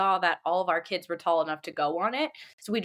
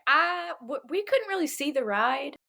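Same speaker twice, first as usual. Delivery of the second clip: put-on higher-pitched, unsure kid voice; mock nervous, pitched well up; 6 semitones higher than usual. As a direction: imitate kids having second thoughts. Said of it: "are looking a little nervous".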